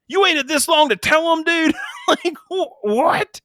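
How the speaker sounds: high, laughing disbelief; high laughing what-are-you-doing; incredulous. Real, not acted. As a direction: throw up your hands, half laughing. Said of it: "incredulous rant, laughing".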